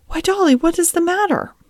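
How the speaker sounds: high-pitched, concerned question; startled concern. A narrator's reading. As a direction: ask in alarm, voice lifting high. Father finds child sobbing.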